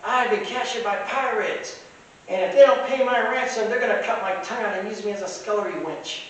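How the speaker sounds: high-pitched imitation of a six-year-old; little girl. Little princess telling a lady of her capture, childlike. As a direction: recite it in a put-on little girl voice. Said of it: "And she goes".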